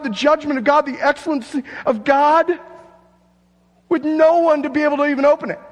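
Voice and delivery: desperate to see what's inside; anxious onlooker. Acted. Onlooker longing to open the scroll, high, loud and pleading.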